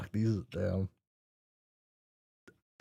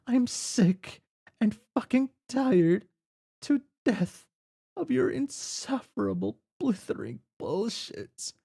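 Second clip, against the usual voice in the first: angry player character ranting at her friend; fed-up rant; 8 semitones higher than usual. Acted. Jane blowing up at Jake.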